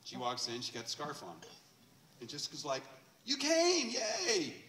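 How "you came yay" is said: excited welcome. Retold or imitated. Jessica greeting the returning lady.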